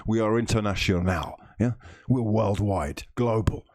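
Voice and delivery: ordinary talk, nothing marked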